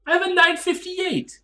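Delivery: high, outraged protest; indignant. Real, not acted. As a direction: squeak out the proof, offended. Indignant over his time.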